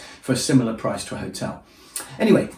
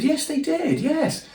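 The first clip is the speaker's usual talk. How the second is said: much higher, guilty-innocent yes; sheepish innocent yes; slower than usual. Retold or imitated. To the B and B hosts, a squeaky guilty yes. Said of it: "I said".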